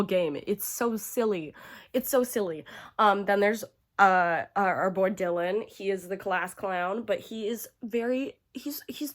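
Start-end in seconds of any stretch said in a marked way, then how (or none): none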